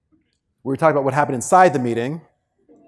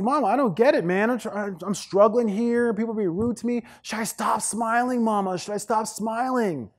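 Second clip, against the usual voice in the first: high-pitched complaint to mom; whining son; 7 semitones higher than usual. Retold or imitated. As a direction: plead with mama like a hurt kid. Whining to his mother, retold.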